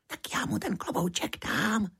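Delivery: put-on high, thin little-boy voice, kept small; small child's whisper. A narrator's reading. Cipísek offering to return the hat, small high whisper. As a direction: pipe up secretly in a tiny high voice. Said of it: "špitl Cipísek".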